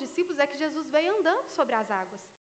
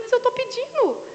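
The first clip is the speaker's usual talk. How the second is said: indignant protest; 9 semitones higher than usual. Retold or imitated. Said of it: "Eu falei".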